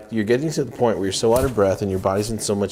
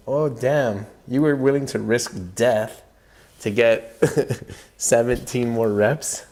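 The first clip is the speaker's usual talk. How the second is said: laughing; 4 semitones higher, slower than usual. Retold or imitated. Laughing at his own stubbornness.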